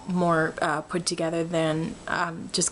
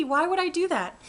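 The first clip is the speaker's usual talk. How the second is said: 1 high amused; 10 semitones higher than usual. Real, not acted. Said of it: "That's creepy".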